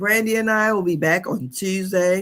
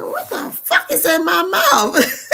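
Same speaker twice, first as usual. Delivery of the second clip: slow, higher, louder line with laughter in it; retold laughing disbelief; 12 semitones higher, 5 dB louder, slower than usual. Watching her funeral video in disbelief, laughing. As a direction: replay the shock while cracking up. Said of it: "And I kept saying".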